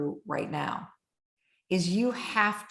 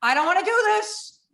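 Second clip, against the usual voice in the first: high, loud panicked plea; panicked pleading; 10 semitones higher, 5 dB louder than usual. Retold or imitated. Retelling the skydive jump, higher and louder in panic. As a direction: beg not to be pushed out, panicking. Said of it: "now I'm like".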